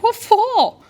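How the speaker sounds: high, incredulous yelp; shocked disbelief. Retold or imitated. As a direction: act out your own stunned reply. Retelling her shocked reaction.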